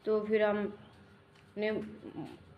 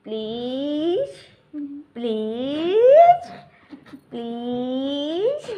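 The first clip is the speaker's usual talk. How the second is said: very slow, much louder drawn-out please; whiny pleading; 5 semitones higher, 9 dB louder, much slower than usual. Pretend. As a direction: stretch please into a long loud whine.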